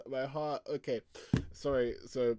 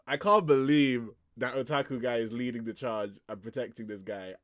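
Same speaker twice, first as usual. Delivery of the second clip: normal talking voice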